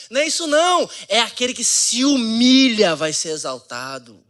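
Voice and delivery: loud, high fiery preaching; preaching at full force. Real, not acted. Preacher rebuking a popular saying, loud and high. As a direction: hammer the point home to the church.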